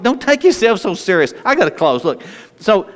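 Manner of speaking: ordinary talk, nothing marked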